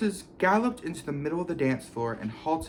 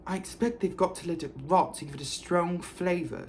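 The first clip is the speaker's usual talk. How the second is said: higher, knowing little lecture; knowing Hermione; 5 semitones higher than usual. A narrator's reading. Hermione to the boys, mildly know-it-all. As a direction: sound like you know it all. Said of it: "said Hermione knowledgeably".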